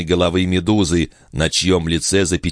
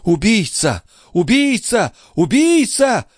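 high shouts, each climbing higher; shrieked, escalating accusation; crying out accusations; slower than usual. A narrator's reading. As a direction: hurl the word with rising hysteria. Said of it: "выкрикивала девушка".

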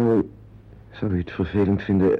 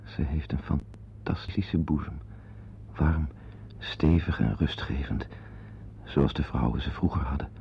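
softer, lower aside to himself; hushed inner thought; 5 semitones lower, 7 dB quieter than usual. Acted. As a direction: think it aloud, hushed and intimate. Inner monologue about her body.

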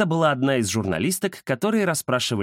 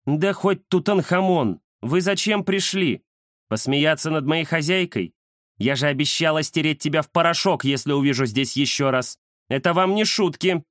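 higher-pitched scolding she-cat; angry cat Индира; 5 semitones higher than usual. Acted. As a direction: scold the intruders as an indignant cat. (сердитая кошка отчитывает котов)

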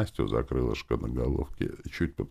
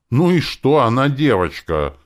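high, loud, spiteful outburst; angry shout; 9 semitones higher, 9 dB louder than usual. A narrator's reading. Yarik defending the girl demon, angry high-pitched shout. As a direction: shout it back angrily. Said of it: "крикнул он злобно".

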